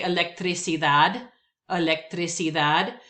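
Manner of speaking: electricidad, stress on DAD; electricidad, rising at the end; Spanish word. Shown as a demonstration. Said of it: "I would stick with electricidad".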